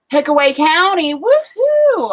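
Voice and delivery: high whooping woohoo; celebrating a county she knows, excited whoop; excited cheer. Real, not acted. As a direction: cheer it like a hometown fan.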